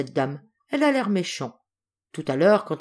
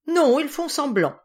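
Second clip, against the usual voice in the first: put-on indignant child; 9 semitones higher, 4 dB louder than usual. Paul rejects their tears.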